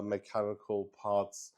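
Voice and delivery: usual speaking voice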